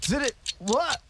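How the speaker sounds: high, startled disbelief; shocked. Real, not acted. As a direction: blurt it out in shock. Host shocked at result.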